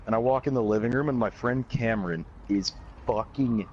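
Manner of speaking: ordinary delivery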